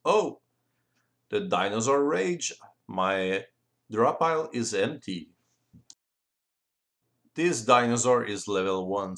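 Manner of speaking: ordinary talk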